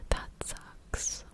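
ordinary talk

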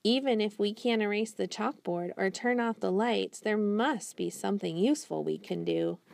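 usual speaking voice

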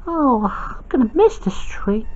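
whispered and soft, missing the tree; a wistful whisper; sad whisper. Acted. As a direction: breathe it out sadly as a whisper. Sad aside about the tree.

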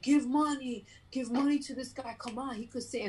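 loud, high imitation of a man rallying a crowd; retold urging. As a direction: call out as the man demanding help for a singer. Imitates man urging passengers to give.

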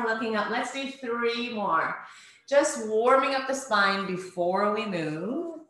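ordinary delivery

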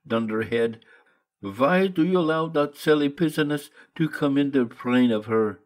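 put-on old painter scolding with a thick German accent. Behrman's German-accented outburst.

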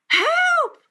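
put-on very high, loud girlish scream; piercing storybook alarm; cry for help. A narrator's reading. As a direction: shriek for rescue as a little girl.

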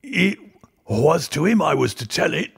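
put-on high, stubborn old man insisting; a very old man who won't budge; petulant old man. A narrator's reading. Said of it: "with the petulant obstinacy of a very old man".

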